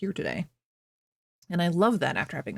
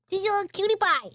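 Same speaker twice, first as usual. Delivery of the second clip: much higher squeaky cutie-pie coo; 13 semitones higher than usual. Real, not acted. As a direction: gush over the dog. Doting on her dog.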